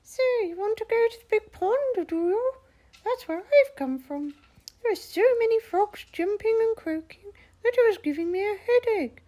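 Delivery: very high, quick squeaky frog chatter; cheerful frog with a headache. Acted. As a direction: chirp it fast like a busy little frog. Frog character speaking.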